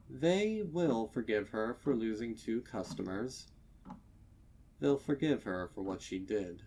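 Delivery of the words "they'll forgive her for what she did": ordinary delivery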